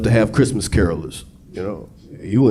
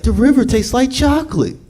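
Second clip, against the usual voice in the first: character voice; 12 semitones higher than usual. Acted. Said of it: "It's like".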